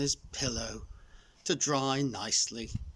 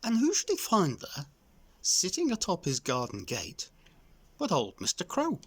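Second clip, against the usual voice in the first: ordinary delivery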